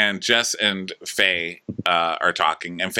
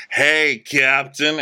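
put-on loud, drawn-out drunken greeting; lightly voiced drunk hailing someone; drunk guy; 6 semitones higher, 3 dB louder, much slower than usual. Drunk guy greets the captain, slow and loud. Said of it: "the drunk guy is like".

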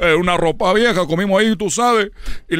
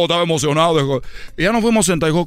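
normal talking voice

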